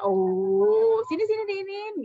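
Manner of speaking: loud 'oh', then high-pitched cooing; fussing parent. Acted. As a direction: coax a hurt child over, acted. Parent soothing hurt child, acted.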